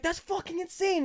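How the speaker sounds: high-pitched disbelief, easing down slightly; amazed reaction. Acted. Imagining a star signed all his stuff, amazed reaction.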